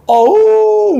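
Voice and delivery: a high howl mimicking a wolf; a retold possessed man letting out a wolf howl. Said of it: "늑대 소리를 내는 거야".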